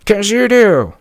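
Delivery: high, loud screeching woman; high furious shriek of a rude neighbor; shrill yelling neighbor. Retold or imitated. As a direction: screech at him like an angry neighbor.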